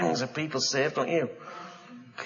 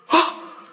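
surprised gasp; 4 dB louder than usual. Acted. Hand restored.

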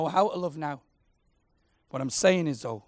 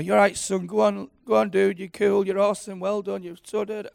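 encouraging dad; 4 semitones higher, 7 dB louder than usual. Retold or imitated. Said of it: "chatting away with our Noah".

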